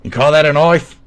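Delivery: put-on high, loud Australian-accented challenge. Challenging someone over a knife, in a loud Australian accent.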